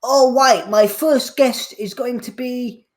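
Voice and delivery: host voice. Retold or imitated. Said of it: "Jonathan Ross comes out".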